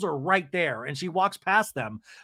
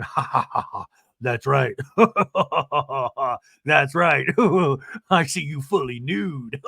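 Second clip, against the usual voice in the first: low lecherous ha-ha laughing; chuckling boyfriend; 3 semitones lower than usual. Retold or imitated. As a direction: imitate a man laughing between pleased remarks. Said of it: "you see John ... going".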